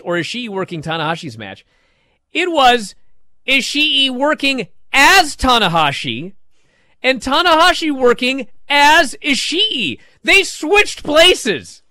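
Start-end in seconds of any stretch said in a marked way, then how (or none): 2.3-11.8 loud, high-pitched excited outburst; thrilled, building to a loud high peak. Real, not acted. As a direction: get worked up and punch out each phrase. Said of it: "I'm so excited".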